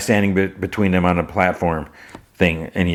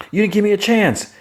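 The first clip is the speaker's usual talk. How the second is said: much higher, whiny complaint; high whiny protest; 14 semitones higher than usual. Retold or imitated. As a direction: whine it up high. Protesting after being shot at.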